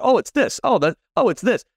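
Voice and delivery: high-pitched mock "oh, it's this"; mock discovery. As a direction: lampoon your own surprise at the same old mistake. Mocking own repeated realizations.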